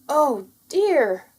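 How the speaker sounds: small rabbit upset at the crash; dismayed. Acted. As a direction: gasp it out as the eggs smash. Bertie drops eggs.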